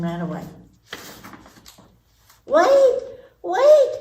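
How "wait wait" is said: high, loud frog shout; high loud frog calling wait; frog crying out. Acted. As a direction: call after her desperately. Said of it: "cried the frog".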